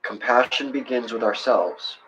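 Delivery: ordinary talk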